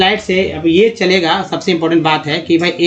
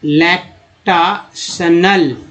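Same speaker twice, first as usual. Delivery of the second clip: slow sounding out; much slower than usual. Shown as a demonstration. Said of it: "teacher sounding out word".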